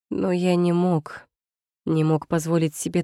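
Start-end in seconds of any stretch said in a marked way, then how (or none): none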